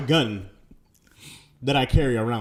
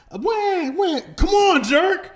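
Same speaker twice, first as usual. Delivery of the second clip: high, shrieking outburst at the other driver; furious yell; 5 dB louder, much slower than usual. Pretend. Father screaming at the driver, daughter in the car, raging.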